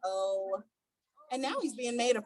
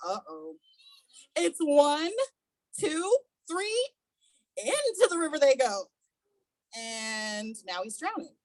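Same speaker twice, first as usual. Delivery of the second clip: plain reading voice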